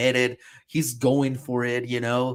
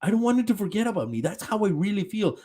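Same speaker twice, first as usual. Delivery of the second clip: higher, rushed mock sob voice; mock crying Eren; 6 semitones higher, faster than usual. Retelling Eren confessing to Armin, mock crying. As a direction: blurt it like a tearful kid. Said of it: "crying. He's like".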